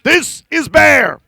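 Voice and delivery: loud, puffed-up bear bragging and warning; big blustering bear voice; boastful bear. Acted. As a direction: boom it out like a bully bear.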